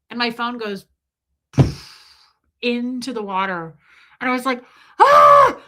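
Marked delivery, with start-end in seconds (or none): 5.0-5.6 loud, high comic shriek; mock panicked scream. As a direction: scream as the phone goes under. Phone drops into the water.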